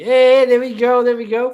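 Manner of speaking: high, loud cheer; cheering a viewer's super chat; excited cheer. Real, not acted. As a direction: whoop for the donation.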